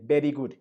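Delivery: "Very good" with Spanish sounds, V as B; Spanish pronunciation. Shown as a demonstration. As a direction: say "Very good" as "beri gud". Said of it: "if you use a Spanish pronunciation to pronounce an English word".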